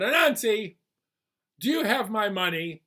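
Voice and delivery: loud, high demanding call. Acted. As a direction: Pig wants his money now. Pig comes demanding his money.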